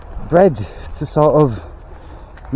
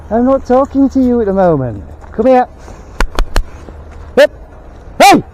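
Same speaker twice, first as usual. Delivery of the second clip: calling the dog; 9 semitones higher, 4 dB louder than usual. Real, not acted.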